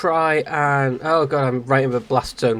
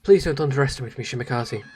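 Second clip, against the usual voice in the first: ordinary talk, nothing marked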